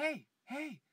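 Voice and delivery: high, loud call to stop; urgent hushing. Acted. Friend urgently stopping him in public.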